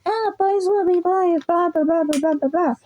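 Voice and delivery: a lightly voiced, brushing-off teacher; dismissive teacher. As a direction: wave it away like a bored adult. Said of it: "didn't just say".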